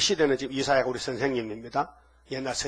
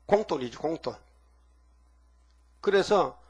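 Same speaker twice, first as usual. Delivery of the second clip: ordinary delivery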